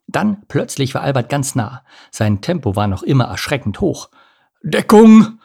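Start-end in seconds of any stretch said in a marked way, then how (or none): 4.6-5.4 high, loud warning cry; shouted alarm. A narrator's reading. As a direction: yell for everyone to take cover. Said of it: "rief er". (laut gerufener Warnschrei)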